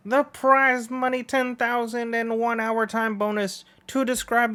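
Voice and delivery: high, bright announcer read of the course; race announcer. Acted. Announcer laying out the race course for the crowd, upbeat. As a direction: call it out like a stadium announcer.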